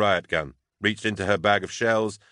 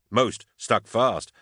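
usual speaking voice

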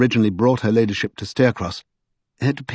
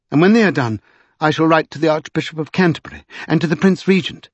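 resolute lady, pitched higher as she goes on; Lady Pole; 4 semitones higher than usual. Acted. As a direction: insist with growing urgency. Voices Lady Pole in the story.

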